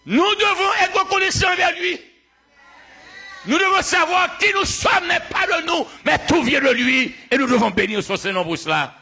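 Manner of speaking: high, shouted proclamation; full-force preaching. Real, not acted. Preacher testifying about his accent, full-force preaching. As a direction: cry it out to the whole congregation.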